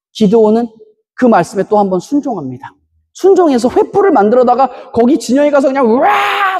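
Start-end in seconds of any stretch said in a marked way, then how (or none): 5.9-6.6 high hammed-up whoop of the soldiers; mock battle cry. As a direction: let out a mock war cry. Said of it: "소리를 질렀는데". (군사들의 함성을 흉내 내며)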